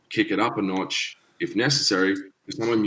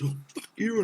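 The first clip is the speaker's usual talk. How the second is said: higher-pitched imitation of a mouthy husband; mock angry outburst; 13 semitones higher, 4 dB quieter than usual. Angry husband cursing the musician, mimicked outburst. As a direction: caricature a drunk man losing it.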